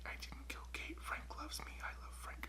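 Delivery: unvoiced eerie whisper; whisper to herself. Sister muttering to herself after the killing, whispered. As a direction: whisper it over and over like a mantra. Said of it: "sits talking to herself".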